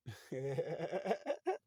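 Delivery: long laugh escaping despite himself; suppressed laugh. Real, not acted. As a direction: try not to laugh and fail. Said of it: "I'm trying not to laugh".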